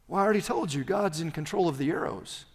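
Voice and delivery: ordinary delivery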